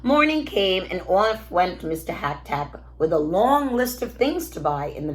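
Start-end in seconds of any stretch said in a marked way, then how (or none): none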